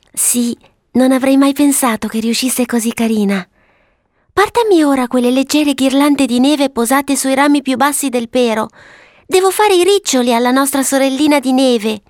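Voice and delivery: put-on high, pleased child's voice; little girl Violetta. Violetta asking for snow to make curls, high girlish voice. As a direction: play a girl busy with her creation.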